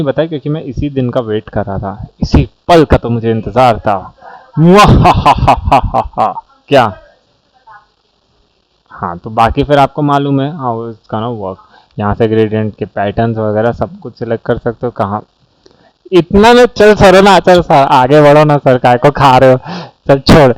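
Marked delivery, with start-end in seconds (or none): 4.6-6.4 slow hammy villain cackle; evil laugh. Acted. Waited for this moment, villain laugh. 16.1-20.5 loud mock heckling; loud teasing. Mock pushy classmate, loud banter.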